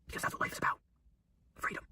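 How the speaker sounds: whispered, barely voiced. Real, not acted. To viewers at the peak of his talk, a soft whisper. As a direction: lean in and whisper the point. Intimate climax of a motivational speech.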